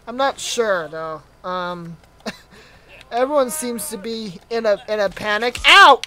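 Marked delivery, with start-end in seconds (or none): 5.7-6.0 loud, high "ow"; pained yelp. Real, not acted. Player hit in the panic, loud yelp. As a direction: yelp at a sudden blow.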